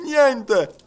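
high, loud one-word exclamation; emphatic answer. A narrator's reading. Servant assuring detective his master knows nothing, emphatic. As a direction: fire back an emphatic denial.

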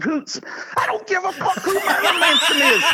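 high laughing mock yell; angry sergeant major. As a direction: bark it like an enraged sergeant. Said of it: "He said".

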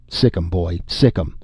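usual speaking voice

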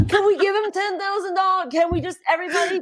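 high-pitched mock-tearful begging; pleading. Host pleading for a loser's prize money, mock-tearful begging. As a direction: beg in a high comic whine.

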